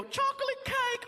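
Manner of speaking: very high, drawn-out catchphrase voice; quoted catchphrase. Acted. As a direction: squeal it slowly, imitating a comedian's bit. Cop quoting comedian's catchphrase.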